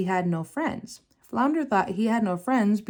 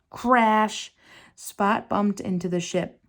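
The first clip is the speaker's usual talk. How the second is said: ordinary delivery, nothing marked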